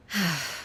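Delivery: soft, falling sigh; low exhaled breath; weary sigh. Acted. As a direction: sigh quietly, done talking. Ruler sighs, tired of talking.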